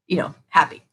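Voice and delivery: ordinary talk, nothing marked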